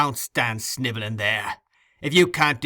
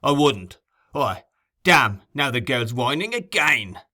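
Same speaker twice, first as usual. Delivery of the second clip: irritated Sykes; 3 semitones higher, 5 dB louder than usual. A narrator's reading.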